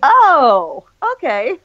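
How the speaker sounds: loud rising-pitched realisation, then backing off; retold surprise. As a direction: react with a mock revelation. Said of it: "I was like".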